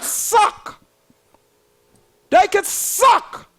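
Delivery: they could suck, SUCK pushed out slow and high; drawn-out suck. Acted. Mocking politicians, a slow scornful drawn-out suck. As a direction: stretch the word suck with scorn.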